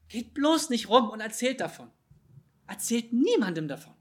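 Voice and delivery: loud, high-pitched insistent plea; urgent warning. Acted. Jesus warns people not to spread it, urgent loud pleading. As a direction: implore them emphatically to keep it secret.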